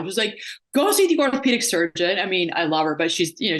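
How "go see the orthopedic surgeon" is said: blunt. Retold or imitated. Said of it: "she's very blunt with me".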